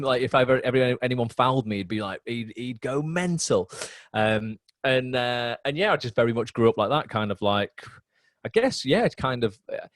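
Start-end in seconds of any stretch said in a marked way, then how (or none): none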